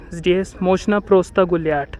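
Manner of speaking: ordinary delivery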